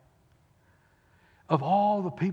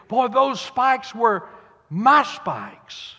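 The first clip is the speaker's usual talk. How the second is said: awed realization; 8 semitones higher, 8 dB louder, much slower than usual. Retold or imitated. Barabbas thinking, loud build.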